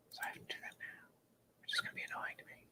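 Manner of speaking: a grumbling whispered aside to himself. Real, not acted. Streamer grumbling to himself about posting on social media, whispered. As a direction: whisper it under your breath.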